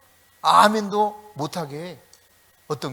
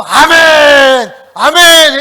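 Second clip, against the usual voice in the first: high, long-held congregational shout; full-force worship cheer; shouted amen; 9 semitones higher, 18 dB louder, much slower than usual. Retold or imitated. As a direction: cry it out at full voice, drawn out. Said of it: "두 손 들어".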